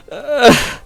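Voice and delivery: high breathless laugh. Real, not acted. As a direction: wheeze it out.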